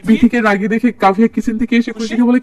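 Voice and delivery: ordinary delivery, nothing marked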